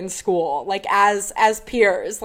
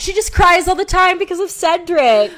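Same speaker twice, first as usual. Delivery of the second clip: explaining Hermione; 8 semitones higher, 4 dB louder than usual. Retold or imitated. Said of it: "And she's like".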